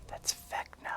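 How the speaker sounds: very soft, voiceless aside; hushed confiding whisper. Acted. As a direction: whisper the name like a secret. Whispers who the Whispered One is.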